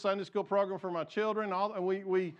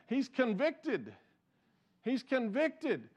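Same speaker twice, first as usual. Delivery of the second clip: normal talking voice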